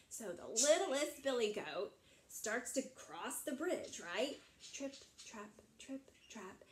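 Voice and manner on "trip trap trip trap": soft, tiny tripping steps; light little footsteps. Acted. Littlest billy goat starting across the bridge, soft and light. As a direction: say each word softly, like small hooves.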